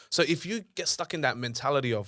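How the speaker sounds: normal talking voice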